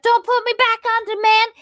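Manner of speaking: very high, loud panicked plea; freaking out. Retold or imitated. Prisoner dreading the sapper, freaking out. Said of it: "is freaking out and is like".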